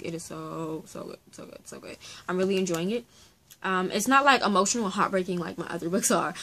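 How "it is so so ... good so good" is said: breathy whispered praise, barely audible. Shown as a demonstration. Vlogger whispering to her viewers how good the book is. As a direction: whisper it like a secret you can't keep.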